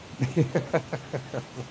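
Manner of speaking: soft real laugh. Real, not acted. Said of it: "That's a real laugh too".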